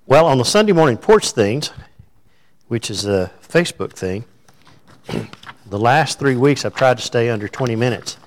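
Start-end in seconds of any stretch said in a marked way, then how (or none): none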